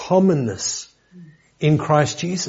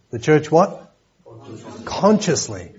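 the word "consciously" drawn out and stressed, called back and forth with the congregation; 3 semitones higher than usual. Shown as a demonstration. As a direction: put the stress firmly on "consciously" and draw it out. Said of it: "I'm emphasising the word consciously".

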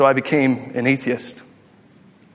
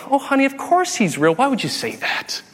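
reassuring mom; 8 semitones higher than usual. Retold or imitated. Mom assures child Santa is real, mock-reassuring. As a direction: imitate a mother soothing and dodging.

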